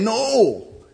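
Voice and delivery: loud, high emphatic no. Real, not acted. Pastor contrasting the world's yes with Jesus' no. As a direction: cut it off firmly.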